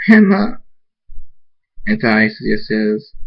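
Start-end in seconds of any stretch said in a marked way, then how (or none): 0.1-0.6 high loud sobbing cry. Acted. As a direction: wail her name. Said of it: "Rain starts to cry and says".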